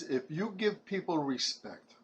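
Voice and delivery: ordinary talk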